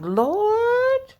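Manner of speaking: high, incredulous cry to the Lord; disgusted disbelief, voice leaping high; exasperated inner thought. Retold or imitated. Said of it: "you be wondering like".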